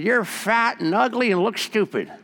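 high mocking parrot insult; rude parrot. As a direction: sneer it like a nasty bird. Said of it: "He said".

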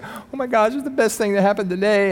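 high-pitched mock-tearful gushing; imitated tearful gratitude. Grieving woman thanks him for a bracelet, imitated tearful gratitude. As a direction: lightly imitate someone overcome, voice lifted high. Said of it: "She starts crying".

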